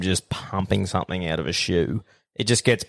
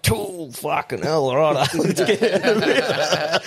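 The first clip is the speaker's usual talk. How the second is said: laughing quote; 5 semitones higher, slower than usual. Retold or imitated. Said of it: "I'm like".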